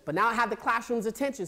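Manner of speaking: usual speaking voice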